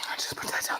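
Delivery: whispered aside, barely voiced. Real, not acted. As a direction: drop to a breathy whisper. Quiet aside to the host.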